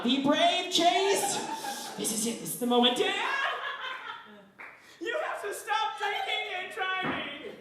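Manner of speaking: high, squeaky rising plea, building to a cracked cry; a boy working up his nerve to confront Dad; psyched-up kid. Retold or imitated. Second-grade son begging his dad to stop, high and pleading. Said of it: "I'm like".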